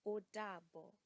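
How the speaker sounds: "Ó dàbọ̀" with a clear open O; Yoruba goodbye. Shown as a demonstration. Teacher models saying goodbye in Yoruba. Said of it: "To say goodbye is".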